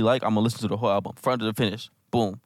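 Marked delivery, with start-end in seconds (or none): none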